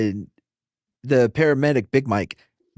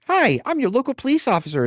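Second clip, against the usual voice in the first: much higher, sugary community-cop voice; mock friendly cop; 11 semitones higher than usual. Mocking the community cop greeting neighbors, sugary and high. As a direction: put on a chirpy helpful officer. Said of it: "going around the neighborhood like".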